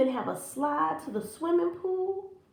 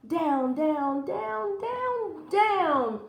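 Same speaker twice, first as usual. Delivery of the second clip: sliding down; 6 semitones higher, much slower than usual. A narrator's reading. Narrator sings out the long slide down the stalk. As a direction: pitch swooping up on each down.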